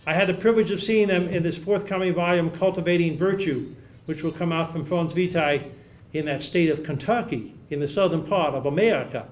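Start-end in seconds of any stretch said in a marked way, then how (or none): 6.1-9.3 deliberately false American Southern accent; playful, badly faked Southern accent; fake southern accent. Shown as a demonstration. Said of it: "that was my deliberate false southern accent".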